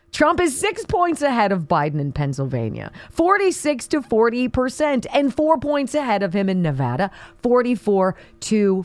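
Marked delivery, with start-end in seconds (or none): none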